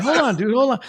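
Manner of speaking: high-pitched, startled hold-off; retold startle. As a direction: fend off a stranger in mock alarm. Startled reply to whispering stranger.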